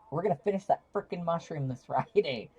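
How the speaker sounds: loud, excited giggly promise; excited laughing. Real, not acted. Got really excited, laughing.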